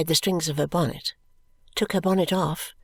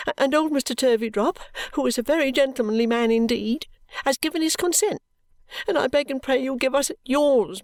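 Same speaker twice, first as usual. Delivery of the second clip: sobbing; 7 semitones higher than usual. A narrator's reading. Daughter sobbing and begging her mother's consent, high and tearful. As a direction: beg through sobs, rising to a cry. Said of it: "sobbed Caddy".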